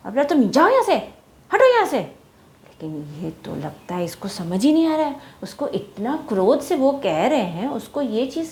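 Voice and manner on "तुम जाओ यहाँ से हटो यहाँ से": high, loud angry shout; angry monkeys. Acted. Furious monkeys yell at the bird to leave, loud. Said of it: "बंदरों को और गुस्सा आया".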